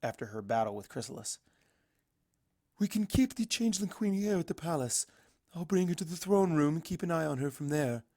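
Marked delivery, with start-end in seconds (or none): 2.8-8.0 higher, lightly strained regal voice; a touch of strain in a high, regal mare; strained princess voice. Acted. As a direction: speak like a tired princess holding a spell. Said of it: "voice a little bit strained by making the effort so soon".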